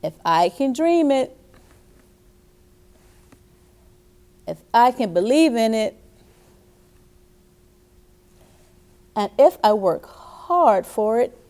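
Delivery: put-on loud, emphatic motto for children to echo; rousing storybook chant, spoken in separate phrases; repeat-after-me reading. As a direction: deliver each phrase firmly, leaving room to repeat.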